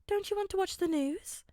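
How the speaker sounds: high, gentle mother checking on son; caring mother. A narrator's reading. Mother concerned.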